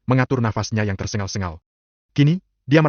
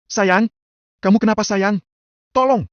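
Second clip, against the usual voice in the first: high-pitched alarm rising to a cry for help; panicked cry; 11 semitones higher, 4 dB louder, slower than usual. A narrator's reading. Wife sees her husband convulsing, panicked cry for help. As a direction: cry out, pitch climbing sharply. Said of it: "Cassandra berteriak kencang".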